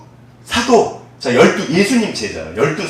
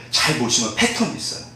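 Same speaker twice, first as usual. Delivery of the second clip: ordinary delivery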